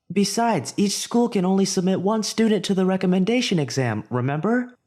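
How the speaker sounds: put-on a nervous teen boy making his point; lightly voiced Izuku. Izuku's dialogue voiced by narrator.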